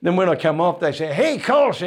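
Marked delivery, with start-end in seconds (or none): 1.1-1.7 high mock-Southern greeting; Southern US accent. Band members greeting comedian coming off stage, high Southern American accent. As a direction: hail a mate in imitation American. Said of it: "so the accents have always been".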